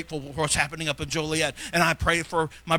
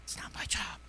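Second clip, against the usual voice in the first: very soft whisper; whispered excuse; 13 dB quieter than usual. Acted. Preacher echoing his flock's excuse, whispered. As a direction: repeat the excuse in a hushed whisper.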